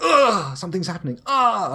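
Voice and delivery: high, loud cartoon cries of alarm; anime exclamations. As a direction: yelp like a startled anime hero. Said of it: "It's like".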